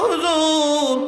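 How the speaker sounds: put-on high, wailing child's voice calling out; crying boy's plea. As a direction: plead like a sobbing orphan boy. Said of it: "ছেলেটা ডাক দিয়ে কয়". (এতিম ছেলের উঁচু গলায় কান্নাভরা ডাক)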